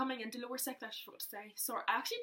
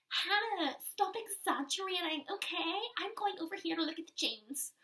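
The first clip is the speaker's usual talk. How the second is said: higher, louder mock big sister; dismissive sister; 8 semitones higher, 5 dB louder than usual. Sister telling Hannah she is exaggerating, impatient. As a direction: brush her off, impatient.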